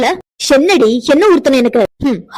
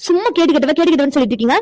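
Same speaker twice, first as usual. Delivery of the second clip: ordinary delivery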